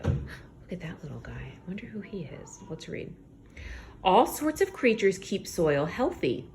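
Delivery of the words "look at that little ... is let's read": hushed, wondering whisper; curious whisper. Real, not acted. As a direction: whisper like spotting a tiny creature.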